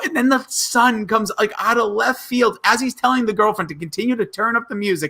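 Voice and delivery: ordinary talk, nothing marked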